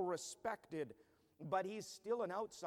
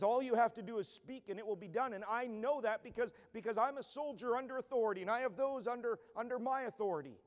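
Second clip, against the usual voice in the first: soldier speaking; 6 semitones higher, 4 dB louder than usual. Acted. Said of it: "And the centurion says".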